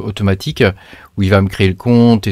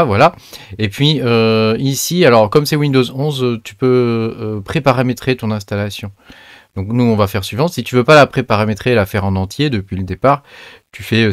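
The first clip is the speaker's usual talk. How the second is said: ordinary talk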